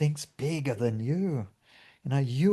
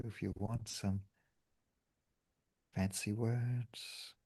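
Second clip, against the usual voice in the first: guided meditation; 8 dB quieter than usual. Acted. Leads a meditation.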